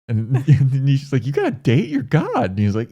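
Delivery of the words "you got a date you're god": high, disbelieving squeak; outraged disbelief, pitched way up; high-pitched shock; incredulous. Retold or imitated. Nishi incredulous at God.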